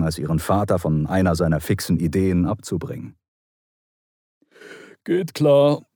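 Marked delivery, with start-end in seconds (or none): 5.0-5.9 higher-pitched reply through a yawn. A narrator's reading. Diver Roger agrees to the plan, yawning. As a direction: yawn the words out as you agree. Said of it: "Roger gähnte".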